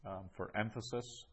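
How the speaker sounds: ordinary talk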